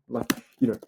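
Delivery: ordinary talk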